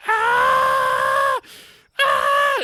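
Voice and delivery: shrill high goblin shriek of pain; goblin death scream. Acted. GM voicing burning goblins, shrill death scream.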